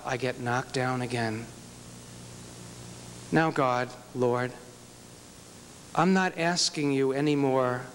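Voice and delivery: low, hushed, pleading prayer to God; personal prayer. Retold or imitated. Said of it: "You have to learn how to pray personally like that".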